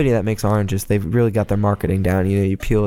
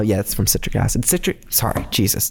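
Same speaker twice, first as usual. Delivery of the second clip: ordinary talk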